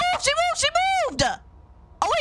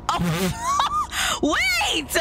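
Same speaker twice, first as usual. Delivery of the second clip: very high squealed outburst; shocked shriek. Real, not acted. Player caught off guard by the game, shocked shriek. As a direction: shriek in sudden surprise. Sudden shock while playing.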